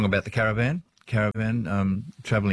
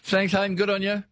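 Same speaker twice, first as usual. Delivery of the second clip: higher, upbeat sign-off to the caller; cheery farewell; 9 semitones higher than usual. Real, not acted. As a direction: send him off with a big happy lift. Host warmly farewells caller.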